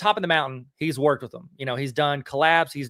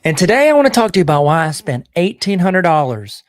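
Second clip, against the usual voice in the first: loud, high announcer-style opener; booming show intro greeting; hyped podcast intro; 4 semitones higher, 11 dB louder than usual. Acted. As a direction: hype up the crowd like a show host. Podcast intro hype read.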